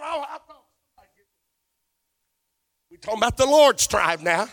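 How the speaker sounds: usual speaking voice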